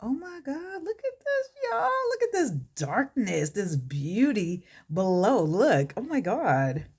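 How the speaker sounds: squealing high over the blend, overjoyed; gushing excitement. Real, not acted. Showing viewers her eyeshadow, high squealing delight. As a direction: gush like it's stunning.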